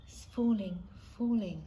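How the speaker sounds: ordinary delivery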